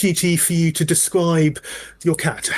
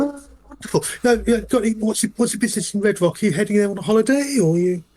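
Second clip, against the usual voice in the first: higher-pitched chatty conductor; polite small talk; 8 semitones higher than usual. Acted. Conductor chatting to a passenger, polite small talk. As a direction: make breezy small talk, a little higher. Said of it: "doing that kind of polite small talk".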